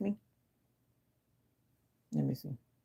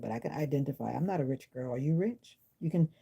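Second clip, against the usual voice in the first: usual speaking voice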